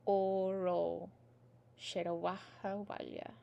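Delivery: slow, separated Irish phrases, softly finished; nice and slow. Shown as a demonstration. Teacher saying the words all in a big lump, nice and slow. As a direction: recite it slowly in little chunks with long pauses. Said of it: "I'll say it nice and slow".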